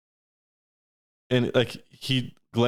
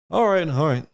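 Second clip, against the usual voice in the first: higher halfling guard acknowledgement; lightly voiced guard; 5 semitones higher than usual. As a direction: accept it as the little guard. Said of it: "And the guard's like".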